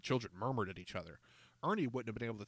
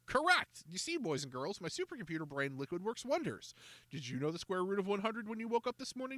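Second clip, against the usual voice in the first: put-on very high Correct, then a showy pitch to the kids; smug salesman; 9 semitones higher than usual. A narrator's reading. George to the boys and girls, smug pitch. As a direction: play the showman to the crowd.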